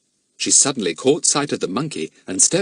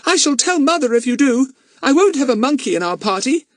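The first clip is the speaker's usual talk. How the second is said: put-on bossy girl; 6 dB louder than usual. Dinah threatens.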